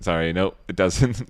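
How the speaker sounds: normal talking voice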